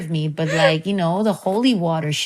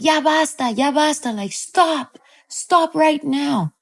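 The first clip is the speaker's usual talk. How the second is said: imitated mother, pitched up and insistent; mom's command; 8 semitones higher than usual. Mom holding child's wrist, raised imitated commanding voice. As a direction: act out a mom ordering her child to stop. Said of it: "she's holding on to my wrist, being like".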